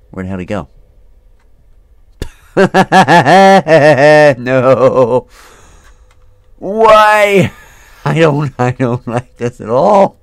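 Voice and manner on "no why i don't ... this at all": loud, high scared whining; real fright; nervous laughing fear. Real, not acted. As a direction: laugh through the panic.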